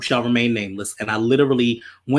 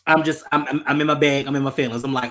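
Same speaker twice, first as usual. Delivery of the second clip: ordinary delivery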